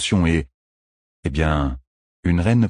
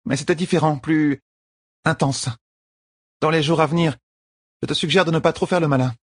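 higher-pitched queen giving news; lightly voiced queen, higher register; informative young queen; 9 semitones higher than usual. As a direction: warn him matter-of-factly, voice pitched up. Voices Elaine warning Matt.

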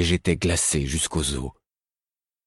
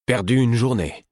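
higher and sharper, almost angry; near-angry retort; 7 semitones higher, 3 dB louder than usual. Acted. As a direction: throw her words back at her. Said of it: "presque avec colère".

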